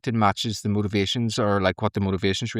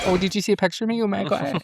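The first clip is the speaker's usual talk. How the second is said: high, gushing pretend delight; mock coy; 10 semitones higher, faster than usual. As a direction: squeal it lightly as a joke. Own flattered reaction, retold in jest.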